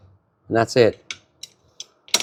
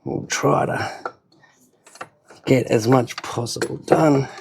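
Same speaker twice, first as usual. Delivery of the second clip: whispering; 5 dB quieter, slower than usual. Real, not acted. Muttered aside while working.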